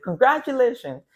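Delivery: high, loud burst of joy; thrilled reading. Retold or imitated. Reading the acceptance email aloud, bursting with joy. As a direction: read it out like you can't believe it.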